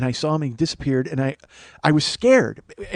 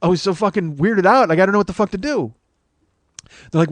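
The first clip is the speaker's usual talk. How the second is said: ordinary delivery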